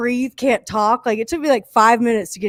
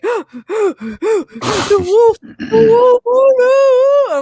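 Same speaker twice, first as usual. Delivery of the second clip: high, loud crying wail; imitating a wailing kid; 14 semitones higher, 6 dB louder, much slower than usual. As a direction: sob it out like a hysterical child.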